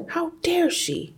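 sudden high outraged cry; indignant. Acted. Jealous father ripping pages, high and outraged. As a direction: spit out his jealous outrage. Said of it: "he seemed to be saying".

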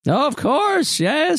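high-pitched knowing agreement; eccentric ancient hostess. Acted. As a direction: agree eagerly in a high character voice. Hostess figure reacting in character.

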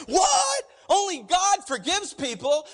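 sudden high shouted what; shocked outburst. Retold or imitated. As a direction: blurt it out in shock, high and loud. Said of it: "And then they were just like".

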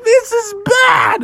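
high, slow cartoon cry; hammed-up doomed shout; panicked wail. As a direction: draw it out in mock despair. Froggy wails in the chase.

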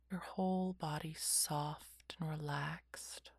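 whispered guided meditation; slow calming whisper, lots of pauses; soft spa whisper. Acted.

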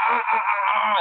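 high, imitated cries of pain; mock pained cries. Answering her as his thigh cramps, mock yelping. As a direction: yelp rhythmically as if cramping.

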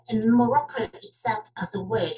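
ordinary talk, nothing marked

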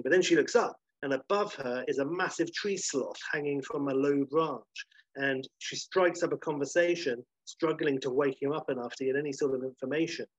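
ordinary talk

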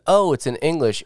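mock startled discovery; surprised realization. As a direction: suddenly realize the obvious. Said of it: "I went".

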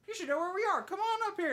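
high, loud beckoning call; acted calling out. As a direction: call someone over from afar. Homelander calling out, retold.

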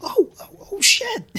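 very high, laughing shrieks of delight; daughter's laughing squeal. Retold or imitated. Dad imitates daughter swearing while laughing at the truck. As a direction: squeal and laugh like a thrilled kid.

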